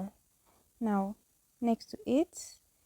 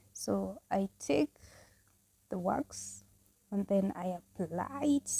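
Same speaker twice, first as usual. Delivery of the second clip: usual speaking voice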